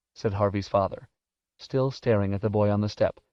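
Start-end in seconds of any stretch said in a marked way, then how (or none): none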